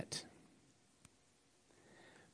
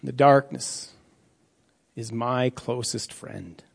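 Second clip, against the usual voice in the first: grave, measured psalm reading; scripture reading. Acted. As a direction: read the psalm like a prayer of anguish. Reads Psalm 88 aloud.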